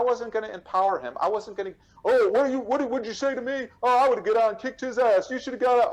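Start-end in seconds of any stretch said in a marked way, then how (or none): 2.0-5.9 high, loud mock tough talk; mock confrontational bluster. Man mocking the reaction he refused to give, blustering. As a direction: puff it up like someone itching for a fight.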